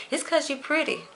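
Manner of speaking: a light, high quoting voice for what everyone says; mock other people. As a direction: toss off the line the way others say it to her. Quoting what people tell her.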